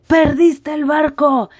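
slow, booming official voice; powerful voice. Retold or imitated. Port agent stopping the narrator, slow and powerful. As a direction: announce it with authority. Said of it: "con una voz muy poderosa".

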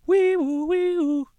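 very high, loud wee woo like a siren; shrill mock siren calls; police siren imitation. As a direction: wail it like a cop car.

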